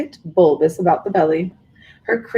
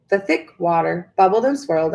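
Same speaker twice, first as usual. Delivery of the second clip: plain reading voice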